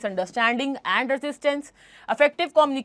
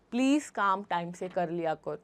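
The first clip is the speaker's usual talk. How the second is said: softer, lower, gentle asking; polite request; 7 semitones lower, 9 dB quieter than usual. Shown as a demonstration. Boss politely asking employee to be on time, soft and gentle. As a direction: ask nicely, no pressure at all. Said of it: "politely बोला ना".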